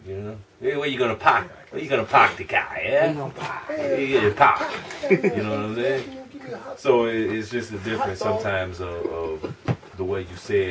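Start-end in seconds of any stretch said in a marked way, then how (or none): 0.6-4.6 playful higher-pitched Boston impression, r's dropped; Boston accent. Imitating Bostonians parking the car, mock Boston accent. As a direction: put on Boston speech, "pahk the cah". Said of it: "You go to Boston".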